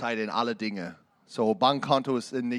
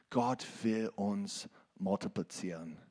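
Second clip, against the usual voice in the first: ordinary talk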